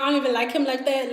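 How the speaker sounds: ordinary talk, nothing marked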